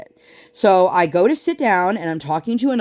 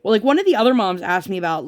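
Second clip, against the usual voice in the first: usual speaking voice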